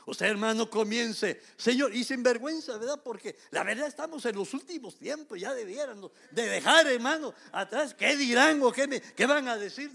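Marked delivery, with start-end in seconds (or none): none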